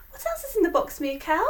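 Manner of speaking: usual speaking voice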